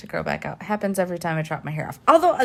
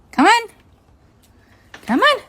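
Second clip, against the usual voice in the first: high, louder coaxing call; calling the dog; 8 dB louder than usual. Real, not acted. Owner calling her guilty dog to come. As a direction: call the puppy over, sing-song.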